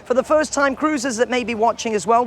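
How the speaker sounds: normal talking voice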